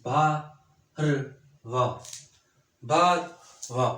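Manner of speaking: बारवा stretched very slowly, each sound held; slow drawn out. Shown as a demonstration. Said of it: "जैसे देखें ना". (बारवा को बहुत धीरे खींचकर)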